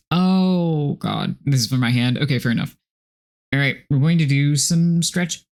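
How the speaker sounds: usual speaking voice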